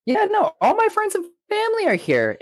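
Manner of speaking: very high squeaky put-on voice; mock Goku. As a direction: squeal like a cartoon hero in a panic.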